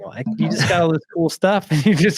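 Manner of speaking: acted-out exasperation, quick swings in pitch; exasperated dad. Dad scolding his pessimistic boy, mock exasperation. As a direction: play a fed-up dad, half laughing.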